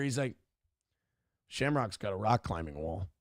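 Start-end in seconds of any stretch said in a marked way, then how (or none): 1.5-3.1 lower, softer, smug; smug unimpressed kid. Retold or imitated. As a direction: shrug it off like a know-it-all kid. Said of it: "purposely being not impressed".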